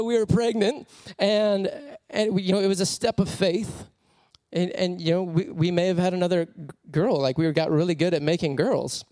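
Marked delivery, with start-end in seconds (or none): none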